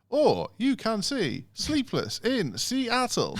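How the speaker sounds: high, slow recorded-message voice; sing-song menu option; automated phone voice. Retold or imitated. Phone menu offering another film, robotic. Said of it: "and then it'd be like".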